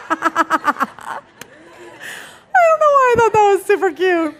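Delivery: giggling at how cute the joke was; laughing. Real, not acted. Speaker giggling at her own airplane joke, laughing.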